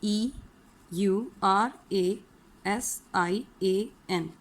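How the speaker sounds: slow letter by letter; slow spelling. Shown as a demonstration. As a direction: spell each letter slowly. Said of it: "spelling it out".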